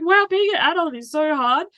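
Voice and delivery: put-on high, dismayed kid voice; seven-year-old son. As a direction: imitate a little child overwhelmed by grown-up life. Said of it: "He's like".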